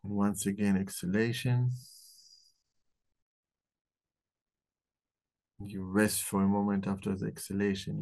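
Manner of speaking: calm guided breathing meditation. Acted. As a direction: ease them into the breath, gently.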